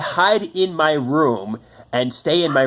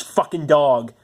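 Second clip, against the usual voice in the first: ordinary talk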